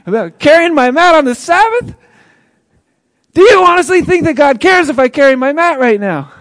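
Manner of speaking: loud, high incredulous outburst with laughs; the healed man's unspoken retort to the rule-quoting leader; exasperated disbelief. Acted. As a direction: laugh in his face in disbelief.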